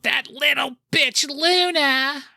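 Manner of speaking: drawn-out, very high mock shriek; hammed-up shrill catfight callout; bad Sable impression. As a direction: call it out in a high, slow, badly imitated screech. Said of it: "I can't do a good Sable".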